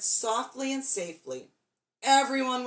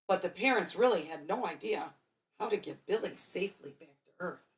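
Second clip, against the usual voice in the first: usual speaking voice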